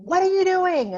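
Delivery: loud, high exasperated parent shout; mock yell. Parent snapping at a child sprawled on stairs, loud mock yell. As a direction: bark it like a triggered parent. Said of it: "we start yelling at them".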